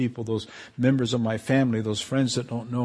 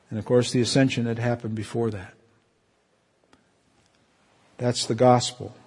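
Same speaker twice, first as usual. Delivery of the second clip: ordinary talk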